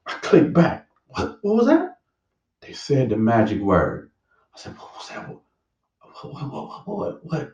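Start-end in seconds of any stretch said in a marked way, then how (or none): 1.1-2.0 high, startled double take; a sudden startled question, pitched high; startled what. Retold or imitated. Hears the word on TV. 4.8-7.5 breathless whispered questions; urgent unvoiced whats rising in pitch; hushed whisper. Straining to catch the TV word.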